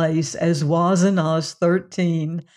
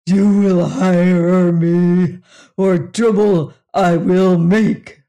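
loud, slow booming threat; huge alien slowly threatening the human; menacing alien threat; 6 dB louder, slower than usual. Acted. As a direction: lay down the threat word by word like a giant.

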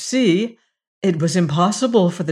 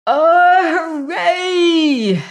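high, loud shout of delight; joyful cry; 11 semitones higher, 6 dB louder, much slower than usual. Acted. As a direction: cheer it out with a triumphant whoop. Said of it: "he cried".

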